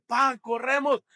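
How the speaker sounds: alarmed shout to run; urgent call. Retold or imitated. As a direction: call out to your dad to flee the shaking house. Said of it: "llega Josué y me dice".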